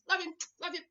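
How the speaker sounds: ordinary delivery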